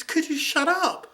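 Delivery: slow sneering shut-up line; snippy retort. Retold or imitated. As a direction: drag each word out, high and pointed. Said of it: "That's all it was".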